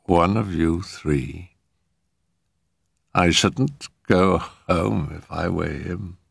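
slow, halting ghostly warning; ominous spirit. A narrator's reading. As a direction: leave gaps between the words, as if from beyond.

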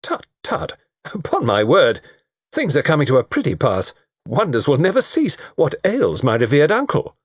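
playful scandalised outcry; mock-shocked exclaiming. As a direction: pile up exclamations in comic disbelief. Harry exclaims over delayed dinner.

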